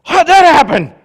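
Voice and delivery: high, surprised, loud mock panic; a flustered deity caught off guard, hammed up; panicked God. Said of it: "he's not sitting up there going".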